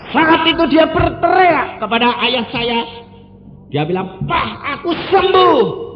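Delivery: high, loud fervent preaching; full-throated sermon delivery; preaching at full force. Acted. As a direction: proclaim it to the whole church. Mother's healing climax.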